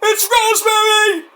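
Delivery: high mock cheer of the crowd; imitated crowd cheer. Fan imitates the crowd cheering her arrival, high. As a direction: cheer like the whole arena. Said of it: "Everybody was like".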